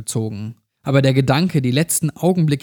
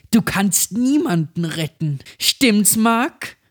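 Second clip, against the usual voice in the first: high mocking child's voice rising; taunting possessed girl; 7 semitones higher, slower than usual. A narrator's reading. The possessed girl mocks Mark, high and taunting. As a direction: taunt him sweetly, climbing up.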